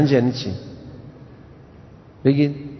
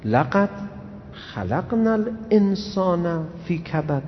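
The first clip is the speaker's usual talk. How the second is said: Quran recitation; 4 dB quieter, much slower than usual. Acted. Preacher quotes Quran verse.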